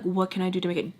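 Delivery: ordinary delivery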